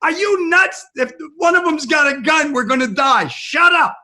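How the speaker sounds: high, loud panicked shout; loud, high shout to make her stop filming; frantic yell. Retold or imitated. Husband yelling at wife.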